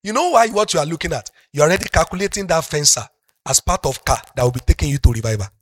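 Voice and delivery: ordinary delivery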